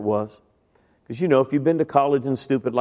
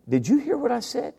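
higher-pitched disbelieving question; incredulous; 9 semitones higher than usual. Retold or imitated. Student to kind stranger who ignored his confession, incredulous. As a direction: challenge him in mild disbelief.

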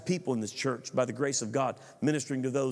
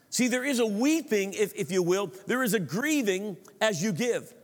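fervent preaching; 5 semitones higher than usual. Acted. Preaches at full force.

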